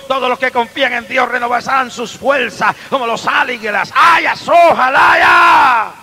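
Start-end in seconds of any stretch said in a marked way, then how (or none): 3.9-5.9 slow high shouted tongues. Real, not acted. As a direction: cry out tongues drawn out. Glosolalia gritada. (lenguas gritadas, lentas y agudas)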